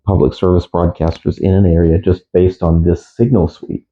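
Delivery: usual speaking voice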